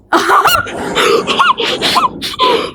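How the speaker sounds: very high breathless squeal of fright; panicked shriek. Real, not acted. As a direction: shriek and gasp at a jump scare. Jump scare in horror game.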